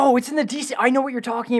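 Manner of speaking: sudden excited realization. Real, not acted.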